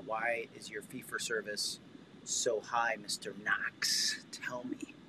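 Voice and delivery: soft teasing whisper to the host. Pretend. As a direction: whisper it like a secret.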